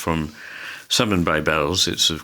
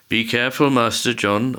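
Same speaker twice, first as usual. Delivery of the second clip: mild call, pitched higher; understated calling voice within a reading; called warning; 6 semitones higher than usual. A narrator's reading. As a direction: call out a caution, only slightly raised. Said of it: "old William called".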